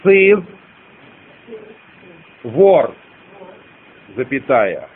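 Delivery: word by word, long pauses between; slow dictation. Shown as a demonstration. Teacher dictating a stanza to students, slow dictation. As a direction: dictate slowly for people writing it down. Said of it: "я медленно продиктую".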